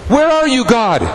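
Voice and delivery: high, pleading cry to God; desperate high plea to God; anguished plea. Acted. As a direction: cry it up to heaven, then drop. Preacher cries out God's absence.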